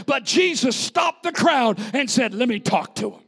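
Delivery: high, loud preacher building in force; fired-up preaching. Real, not acted. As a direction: drive the sermon upward with punched phrases.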